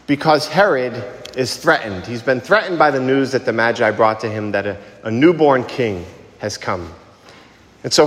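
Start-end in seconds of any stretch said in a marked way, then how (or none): none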